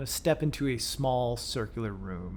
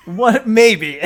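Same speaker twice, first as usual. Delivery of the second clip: laughing; 10 semitones higher, 17 dB louder than usual. Real, not acted. GM laughs at echo question.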